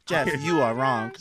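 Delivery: slow, loud robot assistant; robotic voice. Acted. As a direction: pronounce judgment like a talking device. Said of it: "that robotic ass voice".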